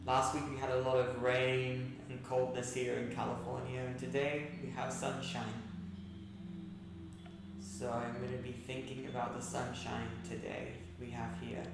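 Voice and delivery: soft, unhurried breathing-exercise voice; guided meditation. Acted. Yoga teacher guiding seated class through breathing and gratitude, soft meditation voice. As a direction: count the breaths softly, leaving long pauses.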